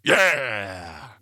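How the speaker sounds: a loud gleeful yeah; gloating over the hit; triumphant cheer. Acted. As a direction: cheer it like you scored. Triumphant cheer after friend hit.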